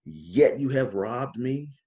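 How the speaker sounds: very slow, drawn-out preacher's accusation; impassioned preacher. Retold or imitated. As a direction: imitate a preacher putting everything into each word. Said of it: "they will put everything into it".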